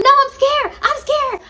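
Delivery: very high, loud scared wail; scared whine. Retold or imitated. Friend scared to pee.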